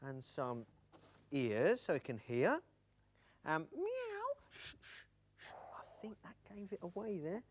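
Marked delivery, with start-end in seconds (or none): 3.8-4.3 very high mewing meow; high playful meow; cat meow. Acted. Sock cat gives itself away. 4.5-7.4 unvoiced hushing whisper; softer whispered shushing. Hushing the puppet.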